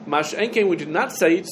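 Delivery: ordinary talk, nothing marked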